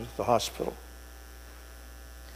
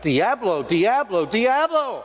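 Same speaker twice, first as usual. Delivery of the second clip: imitated frantic shouting, climbing higher each time; agitated retold shout; 8 semitones higher, slower than usual. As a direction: cry it out repeatedly as the agitated man did, pitch rising. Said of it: "stand there and shout".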